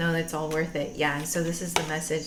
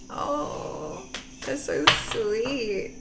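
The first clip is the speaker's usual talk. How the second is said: high, drawn-out aww; sweetly moved, stretched-out words; touched cooing; 7 semitones higher, much slower than usual. Real, not acted. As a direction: coo it slowly, melting. Touched by blushing partner.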